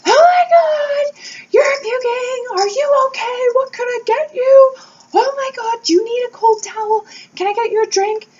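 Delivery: frantic, high, loud fussing mother; super mom mode. Retold or imitated. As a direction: shriek over the sick kid in a panic of care. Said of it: "super mom mode".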